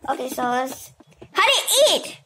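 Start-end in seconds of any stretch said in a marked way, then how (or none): 1.3-2.2 high-pitched mom calling out; acted motherly shout. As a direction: call the child to the table, high and insistent. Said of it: "my mom is shouting at me".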